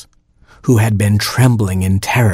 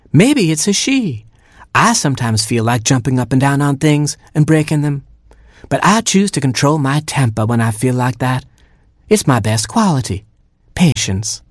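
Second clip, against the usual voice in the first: higher mother hen voice; a fussy mother hen, pitched up; Mama Hen voice; 6 semitones higher than usual. Acted. Mother hen finding her oatmeal eaten, higher voice.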